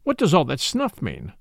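ordinary delivery

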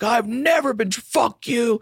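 very high, hammed-up furious patient; imitated high-pitched tirade; mock angry yelling. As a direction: yell it like a raging junkie. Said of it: "he's fucking yelling at him".

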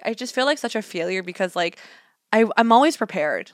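usual speaking voice

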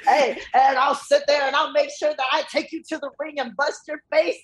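high-pitched imitation of a wrestling promo; mock promo shout. Wrestler threatens opponent beside a noisy plane, mock shouting. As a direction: play a wrestler calling out over noise. Mimics uncle's promo over airplane noise.

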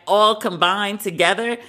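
normal talking voice

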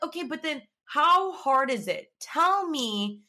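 high, slow, emphatic questioning; exasperated challenge. Retold or imitated. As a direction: demand an answer, stretching the words. Retold exasperated challenge to him.

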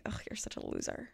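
dismissive whisper under the breath; whispered scoff. Retold or imitated. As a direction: whisper it with contempt. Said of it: "I'm like".

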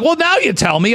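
high-pitched retold exasperation, not shouted; mildly mad. Son retelling his retort to mom, mildly mad. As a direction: act out a teen's peeved comeback, pitch jumping up. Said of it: "like, mad at her".

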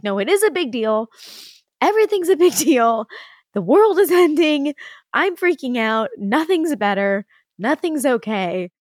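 overwhelmed rant that tapers off; retold meltdown. Retelling her meltdown to husband.

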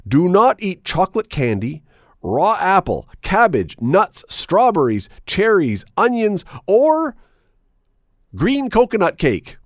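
emphatic, stretched list of forbidden foods; reading in capitals. Acted. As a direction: read the list as if shouted in capital letters. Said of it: "And in capital letters".

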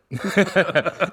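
high, bursting laugh; high-pitched amused laugh; burst of laughter. Real, not acted. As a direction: crack up at the absurd story. Laughing at the quote.

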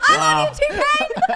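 high, loud squealing through laughter; laughing squeal. Real, not acted. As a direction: gush like a superfan, laughing. Gushing over [name], laughing.

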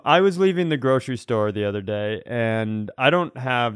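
ordinary talk

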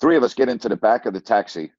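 normal talking voice

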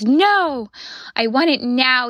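mock a tearful toddler's demand; long wailed "No", lightly acted; imitated toddler sobbing. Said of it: "he's just sobbing".

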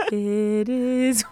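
wobbly, trembling hymn voice; shaky voice. Acted. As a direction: let the voice shake. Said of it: "With a shaky voice".

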